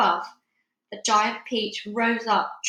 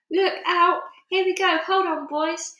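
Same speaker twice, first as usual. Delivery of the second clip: excited call; 8 semitones higher, 5 dB louder than usual. A narrator's reading. Peach lifting, calling out.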